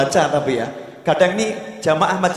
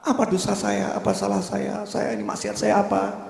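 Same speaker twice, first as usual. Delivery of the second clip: higher, softer plaintive questioning; mild imitated weeping; 4 semitones higher, 7 dB quieter than usual. Weeping woman asking a scholar what her sin was, mildly plaintive. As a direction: lightly act a woman's tearful self-reproach. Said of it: "Wanita ini nangis, nangis luar biasa".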